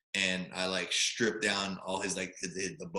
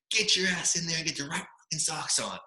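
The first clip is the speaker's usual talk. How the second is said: raised-pitch mock chewing-out; imitated coach's scolding; 7 semitones higher than usual. Coach sending freshman to change socks, mock scolding. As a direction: act out a coach ordering a player off.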